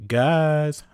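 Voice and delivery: high, loud whiny call for her friends; whiny Buffy. A narrator's reading. Buffy whining to her friends for food. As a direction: whine it like a starving hero. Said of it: "Buffy whined".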